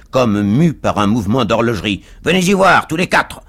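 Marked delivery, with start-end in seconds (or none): 2.2-3.4 loud, high-pitched dare to fight; cab driver's challenge. A narrator's reading. Cab driver challenges four men to fight, loud challenge. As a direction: call them out loudly, pitched up. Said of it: "dit le cocher de fiacre".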